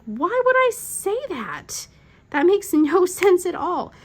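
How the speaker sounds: high-pitched mock protest, stretched out; incredulous husband. Wife mimicking her husband denying the insult, incredulous. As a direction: imitate exasperated disbelief, laugh breaking in.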